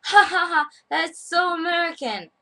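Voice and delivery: high, loud mocking laughter as the client. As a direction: cackle at the American. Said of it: "he starts laughing at me".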